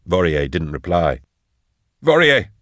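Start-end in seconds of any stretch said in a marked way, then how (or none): 2.0-2.5 high urgent call; crying out. A narrator's reading. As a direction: cry out to rouse him. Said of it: "Drusha cried".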